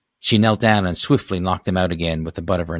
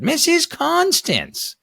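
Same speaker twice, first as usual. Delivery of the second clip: put-on high old woman shouting slowly; shouted call; much slower than usual. Neighbor waving from her steps, high stretched shout. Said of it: "someone shouted".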